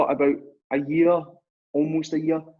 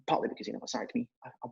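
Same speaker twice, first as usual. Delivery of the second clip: whispered aside; 3 semitones lower, 10 dB quieter, faster than usual. Real, not acted. Quick aside under breath.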